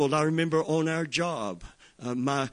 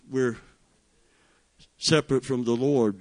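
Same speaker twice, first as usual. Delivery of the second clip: ordinary talk, nothing marked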